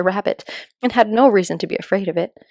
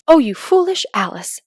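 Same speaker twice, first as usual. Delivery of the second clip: self-scolding; 6 semitones higher than usual. Acted. Alice scolding herself.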